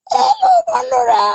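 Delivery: very high squeaky voice breaking into a laugh; acting voice, laughing. Child acting on the live stream, squeaky laughing hello. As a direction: squeak the greeting and giggle. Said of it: "এটাও আমি acting করতে পারি".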